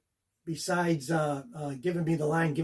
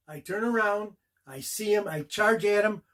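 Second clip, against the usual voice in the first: ordinary talk, nothing marked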